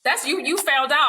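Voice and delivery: high, pointed comeback; high pointed classroom retort. Retold or imitated. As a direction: push back hard on the word sassy.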